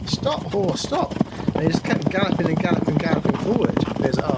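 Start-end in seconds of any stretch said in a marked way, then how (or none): 0.1-1.1 high, frantic whoa and stop; panicked calls. Acted. Rider near the cliff begging the horse to stop, high and frantic. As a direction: pull up a runaway horse in mock panic, voice high.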